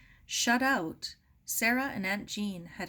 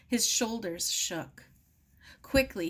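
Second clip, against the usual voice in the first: plain reading voice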